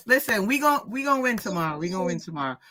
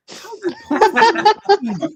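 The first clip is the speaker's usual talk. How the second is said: incredulous retort; 10 semitones higher, 7 dB louder, much slower than usual. Retold or imitated. Mocking his reply in story.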